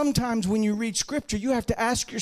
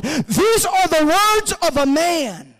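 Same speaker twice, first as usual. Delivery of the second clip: slow, high, loud proclamation; thundering, drawn-out pulpit climax; climactic preaching shout; 7 semitones higher, 6 dB louder, slower than usual. Real, not acted. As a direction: cry it out with long drawn words. Preacher's climax, then calm restatement.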